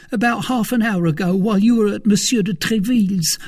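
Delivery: a jittery valet blurting out news; frightened servant Planchet. A narrator's reading. Planchet voiced by narrator.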